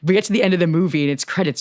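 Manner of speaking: normal talking voice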